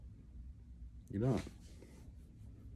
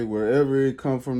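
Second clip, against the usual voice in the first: ordinary talk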